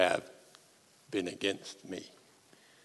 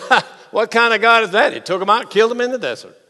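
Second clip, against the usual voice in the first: mocking jeer; 8 semitones higher, 6 dB louder, faster than usual. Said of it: "they would just say".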